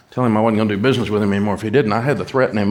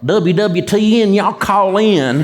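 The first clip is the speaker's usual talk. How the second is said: hillbilly radio voice; 9 semitones higher, 6 dB louder, slower than usual. Retold or imitated. Early radio host mocking his own thick Southern hillbilly voice. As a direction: ham up a twangy Southern hillbilly DJ. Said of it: "This was like".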